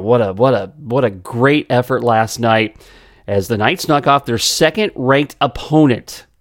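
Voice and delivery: normal talking voice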